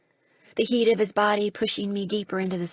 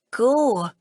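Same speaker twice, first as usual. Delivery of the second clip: put-on louder, hoarse command in his accent; croaked command; 4 semitones higher, 6 dB louder than usual. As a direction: croak it from the ground. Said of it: "croak in his strange accent".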